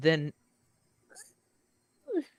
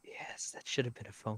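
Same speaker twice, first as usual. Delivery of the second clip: whisper; 5 dB quieter than usual. Acted. Shocked whisper realization.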